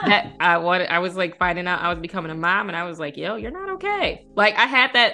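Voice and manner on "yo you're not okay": self-talk quote. Retold or imitated. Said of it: "I said it out loud to myself".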